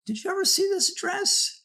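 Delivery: other guy. Retold or imitated. Other man voiced in story.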